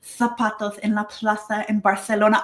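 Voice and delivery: Spanish with the Castilian ceceo lisp; "zapatos" and "plaza" said with a th sound; Castilian Spanish accent. Shown as a demonstration. Learner shows off Spain's ceceo in Castilian Spanish. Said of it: "I love that ceceo".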